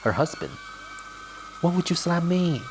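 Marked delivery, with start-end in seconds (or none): 1.6-2.6 indignant wife. Acted. Slapped wife reacting.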